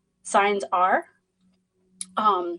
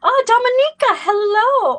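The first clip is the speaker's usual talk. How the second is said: excited greeting; 13 semitones higher, 8 dB louder than usual. Real, not acted. Greeting a viewer in chat.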